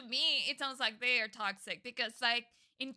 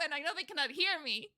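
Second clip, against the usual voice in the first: normal talking voice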